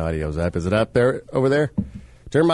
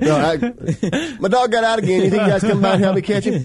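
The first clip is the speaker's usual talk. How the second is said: much higher, put-on caller voice, laughing through it; high mock caller voice; 12 semitones higher, faster than usual. Host voicing a dog owner asking police for help, high mock voice. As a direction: play the ditzy caller asking for help, pitched way up.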